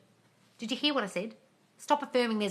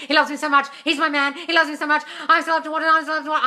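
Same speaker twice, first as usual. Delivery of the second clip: frantic, much higher and louder chanting of love affirmations; mock desperate affirmation, high and loud; obsessive affirming; 9 semitones higher, 14 dB louder than usual. Shown as a demonstration. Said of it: "I don't tell people to do that".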